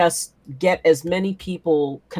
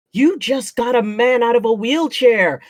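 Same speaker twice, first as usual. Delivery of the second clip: higher-pitched, incredulous exclamation; retold disbelief, pitched up; imitated amazement; 6 semitones higher than usual. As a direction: exclaim it like astonished onlookers. Amazed onlookers after the man walks.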